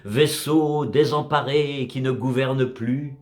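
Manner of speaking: slow, solemn verse reading with measured pauses; poem recitation. Acted. Narrator recites a sea poem about a drifting wreck, measured recitation. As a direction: recite it as poetry, line by line, falling at the ends.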